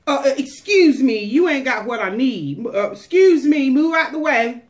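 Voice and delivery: high, loud "excuse me" shoving through; pushy woman in a crowd. Retold or imitated. Woman with the issue of blood, loud and high, pushing past the crowd. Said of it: "And she's like".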